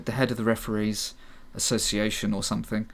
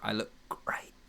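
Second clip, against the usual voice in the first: muttered aside; 3 semitones lower, 9 dB quieter than usual. Acted. Manager mutters to himself.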